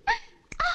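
high "ah" of inspiration; high excited gasp. Acted. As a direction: squeal of a sudden idea. Sudden idea.

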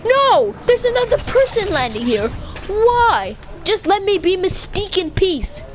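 high, upset complaining; exasperated. Real, not acted. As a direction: whine at the intruders. Annoyed at other players.

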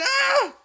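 very high-pitched shriek; panicked scream. Real, not acted. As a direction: scream as you get caught. Scream as enemy catches him.

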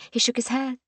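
ordinary delivery

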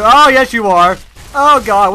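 sudden high, loud yelp of fright; real jump scare, loud and high; startled shriek. Real, not acted. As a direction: yelp as the thing jumps at you. Poked enemy springs to life.

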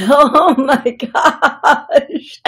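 oh-my-gosh through laughter, high and loud; laughing exclamation. Real, not acted. Psychic host cracking up at the message, laughing exclamation. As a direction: burst out laughing on drawn-out, slow words.

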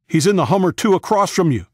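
high, loud shout across a distance; yelled warning. A narrator's reading. Luke warning partner of soldier's position, yelling. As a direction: yell it high over the noise. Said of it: "Luke yelled, his voice loud".

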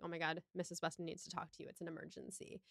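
quick, hushed and urgent. Retold or imitated. Mr Weston hurries to fetch Emma, quick hushed urgency. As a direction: rush the news out under your breath.

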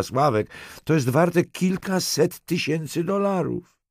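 high, upset woman's voice, acted; tearful, frightened account. A narrator's reading. Halinka tells detective about the threat, frightened and tearful. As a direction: plead your case, close to tears. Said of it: "Dziewczyna znowu zalała się łzami".

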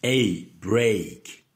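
"A", then "break" with the same long ay glide; diphthong drill. Shown as a demonstration. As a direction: draw out the ay in "break". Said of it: "les belles diphtongues".

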